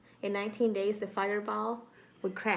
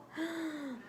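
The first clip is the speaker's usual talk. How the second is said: sharp, breathy gasp of alarm; a storyteller's hushed gasp for the kids; 8 semitones higher, 6 dB quieter than usual. Acted. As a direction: suck in air in mock shock. Reader reacts to scared parents.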